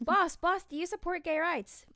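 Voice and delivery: high, loud excited question; excited girl voice. Pretend. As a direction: squeal it like an eager fan. Athena voiced in a TikTok.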